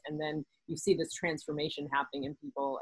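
normal talking voice

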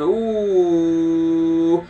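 long, high drawn-out "ooh". Real, not acted. Man exclaiming to himself at the moon, long high ooh. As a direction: hold a high ooh and let it ring.

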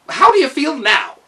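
lively high gnome steward; chirpy servant checking his work; Kaliko. A narrator's reading. Steward asking the mended robot, brisk and chirpy.

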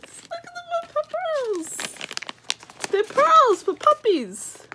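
very high sing-song cooing; excited pet talk. Real, not acted. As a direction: coo over treats for a puppy. Showing treats to her dog.

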